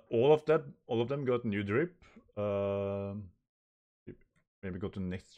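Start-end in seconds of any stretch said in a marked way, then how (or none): none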